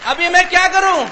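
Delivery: high, loud panic; mock panic over what to do; panicked question. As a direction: ask in desperate panic.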